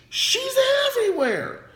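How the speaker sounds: high, loud mock shriek; shrill-pitched exclamation of disbelief; exasperated comic outburst. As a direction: squeal in hammed-up exasperation. Exasperated outburst about meddling woman.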